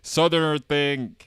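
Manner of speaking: loud, slow, hammed-up Southern; Southern accent. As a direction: belt it out with Southern drawl. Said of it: "it's a Southerner".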